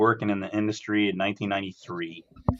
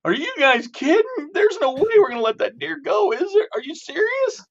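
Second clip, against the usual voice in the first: much higher squeaky incredulous voice; comic high-pitched protest from the back seat; Mogul's disbelief; 3 dB louder than usual. Retold or imitated. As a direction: squeal it like you can't believe what you're hearing. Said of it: "Mogul goes".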